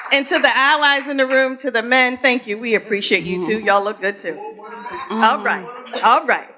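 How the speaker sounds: ordinary talk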